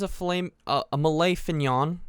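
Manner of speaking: "Malay fignon" with the first sounds of filet and mignon swapped; mispronounced dish name. Retold or imitated. Nervous cook presenting the dish to the chef, flubbed as "Malay fignon". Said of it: "I literally flipped the way you say filet mignon".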